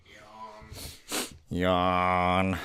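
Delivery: bored nasal Squidward yawn. Shown as a demonstration. Voice tutor demoing Squidward yawn. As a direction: say yawn like you're bored stiff. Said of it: "Just a nice nasally voice".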